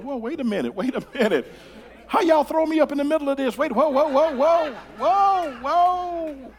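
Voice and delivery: high, rising whoa of alarm; alarmed backpedal. Retold or imitated. Preacher acting his panic at being dragged into a fight. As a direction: pull back fast, caught off guard. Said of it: "I'm going".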